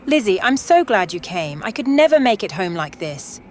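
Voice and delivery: put-on loud, euphoric drunk woman with a British accent. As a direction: gush it out, tipsy and thrilled, British accent. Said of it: "She was loud, speaking English with British accent".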